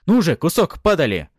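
loud, high snarl; angry shout. A narrator's reading. Gunmen threatening their captive, angry shout. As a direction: yell the insult at full voice. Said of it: "раздались их злобные крики".

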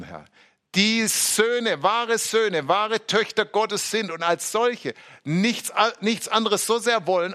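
high, loud pleading prayer; impassioned prayer to the Lord; fervent prayer. Real, not acted. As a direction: pray with rising fervour, pitched high and loud.

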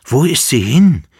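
loud high call. A narrator's reading. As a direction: cry out into the room. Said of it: "rief der Kaiser". (lauter Ruf)

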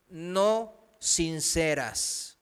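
no sinceras slow and soft, drawn out; slow weighty stress. Shown as a demonstration. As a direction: lean on no, then let sinceras fall slowly. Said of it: "voy a recalcar no sinceras".

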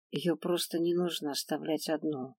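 soft, quiet finish; quietly. A narrator's reading. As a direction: say it softly, trailing off. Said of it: "тихо закончила я".

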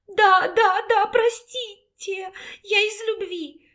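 high, far-off voice begging; frightened pleading. A narrator's reading. Banished woman begs the alpha for mercy, frightened.

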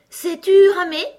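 high, loud demanding question; abrupt sheep. A narrator's reading. Sheep handing Alice needles, abruptly asking. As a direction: fire the question out loudly.

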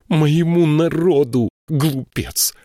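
high, loud tearful outcry; bursting into tears. A narrator's reading. Varsheva crying out at the prince, sobbing. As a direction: hurl it out through tears. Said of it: "разрыдалась Варшева".

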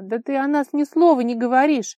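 loud, high, exasperated cry; moaning protest. A narrator's reading. Vara reproaching Kolya about the two of them, wailing. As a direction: wail the reproach at him. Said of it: "простонала она".

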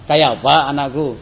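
Banjar-flavoured question, mildly put on; Banjar accent. Shown as a demonstration. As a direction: ask it the Banjar way, a touch loud. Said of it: "Bahasa Banjarnya".

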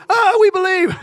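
high, loud gush of instant belief; hammed-up awestruck cry; mock gushing. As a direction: burst out like an overeager convert. Mimics an instant, gullible conversion.

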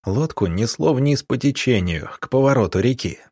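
plain reading voice

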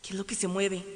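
plain reading voice

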